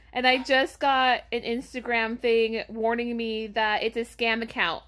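ordinary delivery

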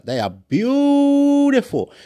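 high, drawn-out BEAUTIFUL; gushing praise. Real, not acted. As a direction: stretch it out in a loud, high gush. Gushing over the people out there.

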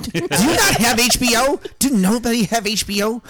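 high, incredulous author voice; mock outrage from a famous writer; George. Author aghast at people without his channel, high mock indignation. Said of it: "I'm George".

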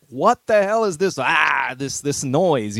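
exasperated parent complaining; a hint of an irritated parent; annoyed parent. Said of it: "And my parents were like".

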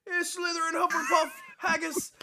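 fake Scottish. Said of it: "You sounded like the fake Scottish guy".

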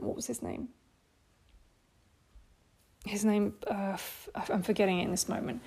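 quiet aside while forgetting; muttered to self. Real, not acted. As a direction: drop away from the crowd and mutter while you try to recall. Forgetting a name mid-sermon.

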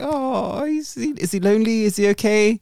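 worried fussing voice. Retold or imitated. Voicing her worried thoughts.

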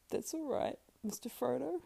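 high-pitched, gentle consolation; tender, forgiving Sam. Acted. Sam wipes his eyes and forgives Frodo, tender.

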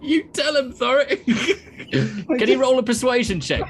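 laughing. Real, not acted. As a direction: cheer him on through laughter.

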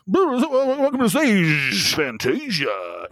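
drawn-out club DJ stage call; DJ announcer voice. Retold or imitated. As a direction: stretch every name like a hammed-up announcer. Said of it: "I did that voice for you of like".

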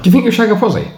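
high-pitched taunting question; teasing high voice. Pretend. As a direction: needle him in a squeaky mock voice.

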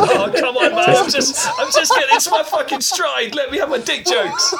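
high, giggling plea through the laughter; laughing protest. Real, not acted. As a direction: beg to keep going while you can't stop laughing. Protesting while cracking up.

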